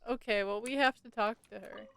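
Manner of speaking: ordinary delivery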